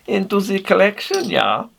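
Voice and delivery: high-pitched mock-German accent. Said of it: "putting on a German accent".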